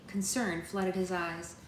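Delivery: plain reading voice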